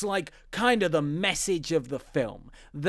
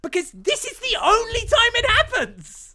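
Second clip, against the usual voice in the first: much higher, louder, almost squealing; incredulous squeak; 4 dB louder than usual. Real, not acted. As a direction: push it up in disbelief. Insisting on the plot point.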